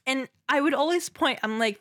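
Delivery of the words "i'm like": ordinary talk, nothing marked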